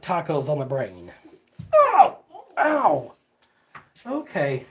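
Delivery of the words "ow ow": yelp of pain. Real, not acted.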